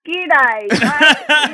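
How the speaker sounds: hammed-up Aussie, laughing at it; mimicked Aussie catchphrase. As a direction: imitate tourists doing an Australian accent. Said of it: "people are like, "You're Australian".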